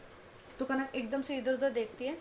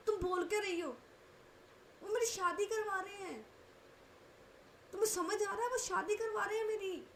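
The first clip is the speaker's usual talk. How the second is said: high, shrill-free indignant outburst, much higher; outraged disbelief; 8 semitones higher than usual. Acted. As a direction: protest in shock and hurt. Kanak outraged at Manvi's advice.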